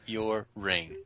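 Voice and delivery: slow, low, deliberate menace; mock threat. Co-host threatening whoever mocks his curling, slow mock menace. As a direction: spell out the threat slowly and quietly, pausing for effect.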